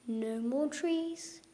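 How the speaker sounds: slow and hushed; sad, slow. A narrator's reading. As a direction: let the loss sink in. Last tree falls.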